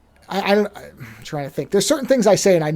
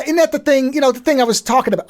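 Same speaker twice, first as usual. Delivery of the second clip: higher-pitched mobster-style line; mob movie talk; 7 semitones higher than usual. Shown as a demonstration. Host showing how somebody in a mob movie talks, mob movie talk. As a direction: do a mob guy, "isn't" as idn't. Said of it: "like somebody in a mob thing".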